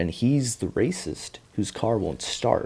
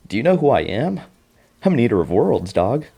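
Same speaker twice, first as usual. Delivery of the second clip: mock indignant monster; 5 semitones higher, 5 dB louder than usual.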